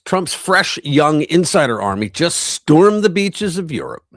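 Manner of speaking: loud, slow, high announcer delivery; headline read. Acted. Streamer announcing a news headline to viewers, loud emphatic read. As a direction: proclaim the headline with big emphasis, dropping at the end.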